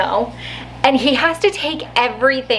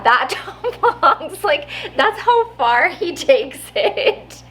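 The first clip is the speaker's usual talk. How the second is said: laughing; 6 semitones higher, slower than usual. Real, not acted. Laughing while retelling the bit.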